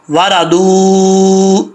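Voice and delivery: वारादू with the final ऊ held very long and steady; long madd. Shown as a demonstration. Teacher says lengthen it, long held vowel. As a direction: stretch the end of वारादू out slowly. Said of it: "लंबा करना है".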